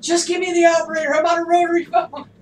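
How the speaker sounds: high, very loud exasperated yell; screaming. Retold or imitated. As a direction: scream it at a useless automated phone line. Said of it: "be, like, screaming".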